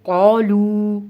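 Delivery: कौलू loud, with long held oo; waw madd. Shown as a demonstration. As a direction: recite कौलू stretching both vowels. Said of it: "वाव मद्दा है". (कौलू, वाव मद्दा खींचकर)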